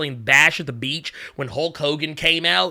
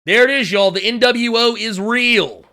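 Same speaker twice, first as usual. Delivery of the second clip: ordinary talk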